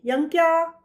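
calling out. A narrator's reading. Teacher summoning a pupil in class, calling out. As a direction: call the name out high across a classroom.

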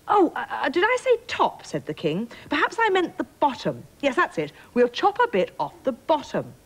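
high-pitched, flustered storybook king; flustered backtracking. Acted. King hastily backtracks on his blunder.

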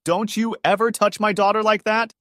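mock angry yell. A narrator's reading. Father pretending fury at daughter's new boyfriend, yelling. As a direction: yell it like an outraged protective father. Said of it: "throws me over the couch and yells".

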